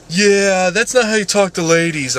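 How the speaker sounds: high, loud mock-female objection; imitating scolding ladies. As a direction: put on an indignant ladies' voice. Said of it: "bunch of ladies be like".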